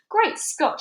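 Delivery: high, loud amazed exclamation; astonished shout. A narrator's reading. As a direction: shout in sheer surprise. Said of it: "shouted the chief of police".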